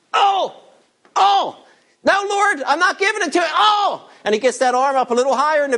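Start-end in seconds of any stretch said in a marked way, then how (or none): 0.1-4.1 high strained yelps of protest; pained grudging giver. Acted. Preacher acts the stingy giver squirming under God's pressure. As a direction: whine like your arm is twisted.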